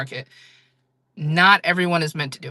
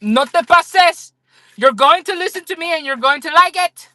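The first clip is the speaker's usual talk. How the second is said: much higher and louder, bossy mock scolding; aggressive kindness; 12 semitones higher, 8 dB louder than usual. Streamer playing her aggressively kind self scolding a viewer, loud high mock scolding. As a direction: boss them into loving themselves. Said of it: "I'd be like".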